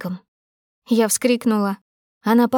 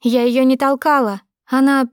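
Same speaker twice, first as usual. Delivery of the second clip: higher, louder, urgent self-defence; heated denial, broken off; desperate protest; 4 semitones higher, 4 dB louder than usual. A narrator's reading. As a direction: protest your innocence, voice raised. Accused, protesting after crying out.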